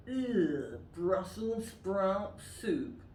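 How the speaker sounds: disgusted. Acted. Disgust at vegetable soup.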